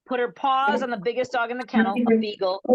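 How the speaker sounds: ordinary talk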